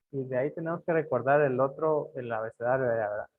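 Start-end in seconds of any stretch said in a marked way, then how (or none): none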